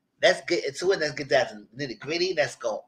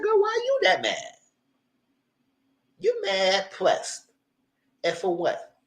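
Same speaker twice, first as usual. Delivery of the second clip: mock high scoff; 8 semitones higher, slower than usual. Mocking Madison's anger.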